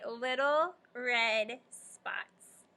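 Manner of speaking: slow emphatic answer. Shown as a demonstration. Teacher answering slowly.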